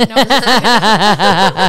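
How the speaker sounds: laughing loudly through the words; real loud laughter breaking up the line. Real, not acted. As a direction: burst out laughing at her own joke. Laughs off her own joke.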